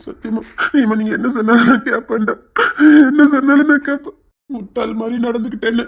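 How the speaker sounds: sobbing, remorseful vow; tearful promise. Acted. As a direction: promise obedience while crying. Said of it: "ஏன்டா அழுதுட்டு இருக்க".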